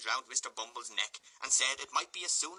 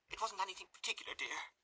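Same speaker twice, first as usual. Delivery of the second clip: high, fast, softer evasive lady; 9 semitones higher, 5 dB quieter than usual. Acted. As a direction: brush it off quickly. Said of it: "said the lady evasively".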